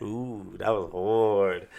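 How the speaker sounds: ordinary talk, nothing marked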